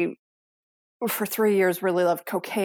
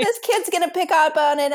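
higher and louder, pressing the point; insistent retort; 10 semitones higher, 6 dB louder than usual. Retold or imitated. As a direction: push back hard on the husband. Arguing with husband, retold.

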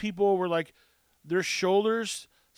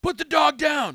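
commanding; 8 semitones higher, 7 dB louder than usual. Retold or imitated. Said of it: "I was like".

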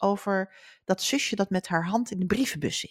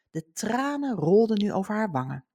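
ordinary talk

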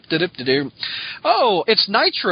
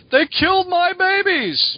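hammed-up high yell of grief, a bit slow; mock yell; 11 semitones higher, slower than usual. Scarecrow mourning his crows, hammed-up high yell. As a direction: wail it like a ranting comic villain. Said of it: "the Scarecrow yelling".

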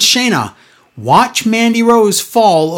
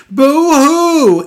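high-pitched hammed-up boo-hoo; badly faked, exaggerated wailing; mock fake cry; 9 semitones higher, 3 dB louder than usual. Wrestler faking tears for the crowd, mock crying. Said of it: "does a fake cry".

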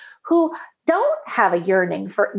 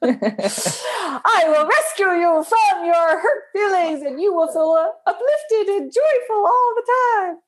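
higher, slow, hammed-up heroic rescuer; mock supermom; 7 semitones higher than usual. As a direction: soar like a caped supermom, half laughing. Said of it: "on goes the supermom cape".

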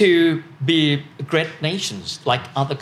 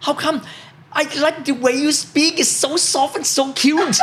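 German stranger; 11 semitones higher than usual. Retold or imitated. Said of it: "German people ... said".

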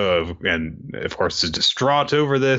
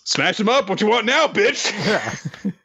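higher, swaggering mock taunt; cocky taunt; 10 semitones higher, slower than usual. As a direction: gloat like a lightly voiced bully. Kohaku taunting after smashing.

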